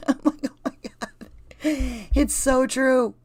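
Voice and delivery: real laughter breaking into the words, high-pitched; helpless giggle while talking; laughing. Real, not acted. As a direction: crack up at yourself. Cracks up at own bad accent.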